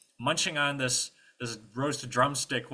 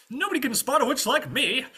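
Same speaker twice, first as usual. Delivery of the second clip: high, loud cocksure knight; boastful knight; 8 dB louder than usual. Acted. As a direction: brag grandly about your witch hunting.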